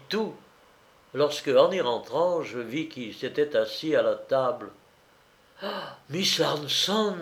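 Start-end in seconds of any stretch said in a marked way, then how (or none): 5.6-7.2 drawn-out, higher-pitched exclamation; exclamation de surprise. A narrator's reading. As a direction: cry the name out slowly in surprise, without shouting. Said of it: "m'écriai-je". (s'écrier, surpris, plus aigu et lent)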